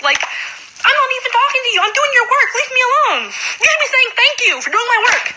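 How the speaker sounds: high, strained voice, close to tears; telling him off while almost crying; upset outburst. Real, not acted. Telling off classmate, near tears.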